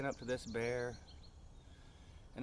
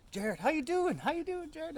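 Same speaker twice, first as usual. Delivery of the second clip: much higher, louder sing-song greeting; people greeting; 4 dB louder than usual. Retold or imitated. People greeting Jared every day, high sing-song voice. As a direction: chirp the greeting like a cheery neighbour. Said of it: "People ask me".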